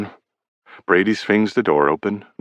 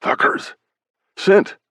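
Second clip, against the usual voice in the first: smug grin; 13 semitones higher, slower than usual. A narrator's reading. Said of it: "says Novi with a smug grin".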